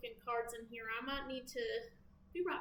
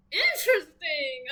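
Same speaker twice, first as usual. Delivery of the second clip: louder, drawn-out Interesting!; loud slow exclaim; 3 semitones higher, 11 dB louder than usual. Pretend. Tarot reader exclaiming Interesting! loudly at a card. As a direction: bellow Interesting! slowly, amazed. Reacting three ways to a card.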